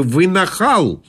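high-pitched, haughty indignation; wrathful queen. A narrator's reading. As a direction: rebuke like an offended queen. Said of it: "с видом разгневанной королевы".

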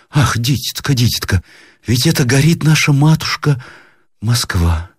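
high, lamenting granny cry; alarmed grandmother. A narrator's reading. Grandmother realises Moscow is burning, alarmed lament. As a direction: cry out, then sink on the last word. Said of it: "вскричала она, всплеснув руками".

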